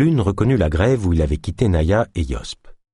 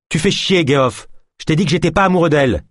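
irritated outburst; 8 semitones higher than usual. A narrator's reading. De Lune snaps at teasing Geoff.

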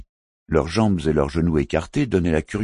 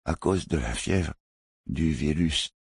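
softer, labored speech through a hurt tongue; injured tongue; 3 dB quieter than usual. A narrator's reading. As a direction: struggle to form words, tongue wounded. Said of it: "Sa blessure à la langue ... rendait son élocution difficile".

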